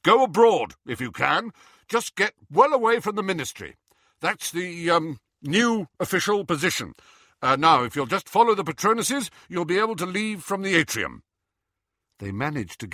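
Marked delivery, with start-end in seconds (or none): 0.0-11.2 higher, improvised official announcement; raised voice directing a crowd; Harry giving instructions. Acted. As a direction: address the group, hesitating briefly.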